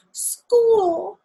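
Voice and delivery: high, loud joyful cry; excited shout. Acted. Girl cheering as she runs to school, loud and high. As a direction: cry it out with delight.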